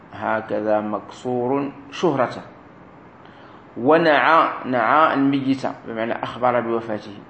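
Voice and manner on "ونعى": ordinary talk, nothing marked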